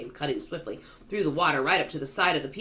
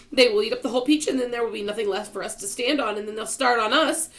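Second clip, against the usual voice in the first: high, loud panicked outcry; distressed and high-pitched; despairing cry; 9 semitones higher, 7 dB louder than usual. A narrator's reading. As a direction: cry it out in rising dread. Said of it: "cried Miss Spider".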